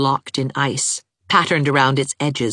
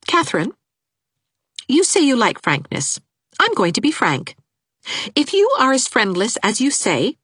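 put-on earnest young woman; 7 semitones higher than usual. Anne speaking frankly.

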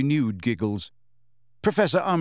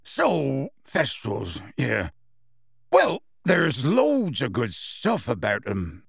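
slow, halting character voice; gruff-mannered teacher, losing his thread; flustered teacher regaining flow; slower than usual. A narrator's reading. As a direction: pick the lesson back up haltingly. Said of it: "clearly struggling to regain the flow".

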